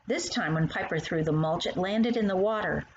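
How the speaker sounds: ordinary delivery, nothing marked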